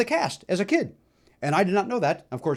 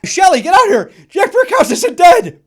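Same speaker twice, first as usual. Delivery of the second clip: much higher and louder, slow shouted call; yelling; 13 dB louder than usual. Retold or imitated. Calling his brother out to meet the fan, a loud excited yell. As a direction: holler across the crowd. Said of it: "yells to his brother Sheldon".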